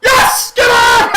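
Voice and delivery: screaming with joy at the penalty; ecstatic shout. Real, not acted. Fan watching the match screams as the penalty is given. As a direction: yell it out, over the moon.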